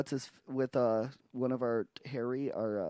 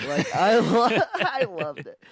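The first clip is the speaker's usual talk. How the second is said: laughing; 7 semitones higher, 10 dB louder than usual. Retold or imitated. Retelling the fan's praise.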